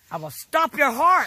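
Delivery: slow, loud menacing threat. Real, not acted. Grandma threatening her grandson, slow and loud. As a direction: lean on every word.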